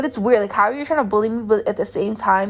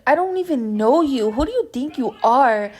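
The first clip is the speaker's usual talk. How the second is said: mildly indignant retort, pitched up; indignant comeback; 4 semitones higher than usual. Retold or imitated. Girl retelling her answer to rude strangers, mildly indignant. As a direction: retell a comeback with a touch of outrage.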